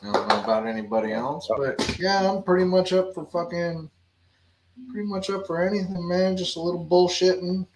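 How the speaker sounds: ordinary talk, nothing marked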